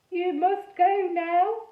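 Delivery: put-on high, slow ghost boy with a French-ish accent; funny French-ish accent. A narrator's reading. Ghost boy Edmund speaking.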